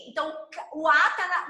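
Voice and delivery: ordinary delivery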